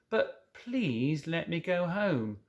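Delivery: slow, small plea to go home; slow pleading. Acted.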